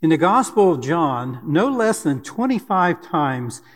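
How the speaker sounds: normal talking voice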